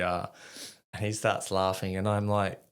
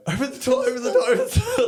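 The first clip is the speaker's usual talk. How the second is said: high, fast panicked shouting; acted frantic high-pitched yell; full panic; 7 dB louder, much faster than usual. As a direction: plead into the radio at top speed, voice shooting up. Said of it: "the full panic".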